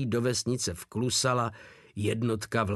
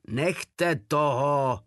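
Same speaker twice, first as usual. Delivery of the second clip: slow, higher command to stop; barked order; 4 semitones higher, much slower than usual. A narrator's reading. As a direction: call it out with each word stretched. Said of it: "houkl dědek".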